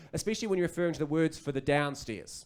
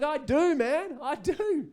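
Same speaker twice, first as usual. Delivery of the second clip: high, loud and thrilled; excited dad; 11 semitones higher, 4 dB louder, much slower than usual. Acted. Dad agreeing with his excited son, high and elated. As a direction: gush it back to the toddler.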